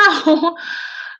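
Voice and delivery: a quick, high little laugh; short laugh. Real, not acted. To the viewers after a strange story, a short high laugh. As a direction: let a brief laugh slip out.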